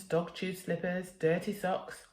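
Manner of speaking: ordinary delivery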